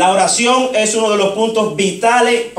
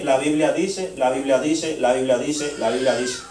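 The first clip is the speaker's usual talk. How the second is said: repeated lower and softer, sinking away; fading refrain; 7 semitones lower, 6 dB quieter than usual. Retold or imitated. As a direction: let each repetition drop in pitch and volume. Recounts his repeated refrain, winding down.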